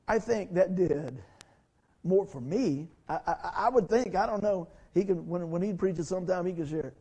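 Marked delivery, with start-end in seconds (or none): none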